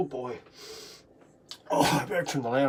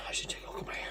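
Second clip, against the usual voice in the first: whispering; 9 semitones lower, 15 dB quieter, much faster than usual. Real, not acted. Quiet aside while high.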